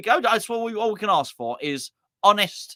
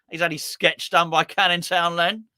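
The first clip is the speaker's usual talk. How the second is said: ordinary talk, nothing marked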